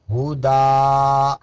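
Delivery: हुदा slowed, ending held on a long open आ; stop form. Shown as a demonstration. Teacher shows students the stop form, हुदा slow and drawn out. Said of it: "या नहीं पढ़ेंगे".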